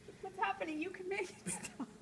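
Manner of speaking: high, whimpering fake plea; hammed-up tearful appeal; mock pleading. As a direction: beg in a pretend tearful voice, about to laugh. Mock plea, breaking into laughter.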